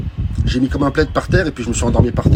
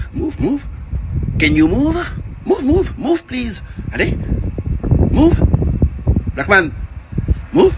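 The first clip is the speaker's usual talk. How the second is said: high, urgent repeated orders; imitated police shouting; mock cop order; 12 semitones higher, slower than usual. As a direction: shout it like a frantic officer.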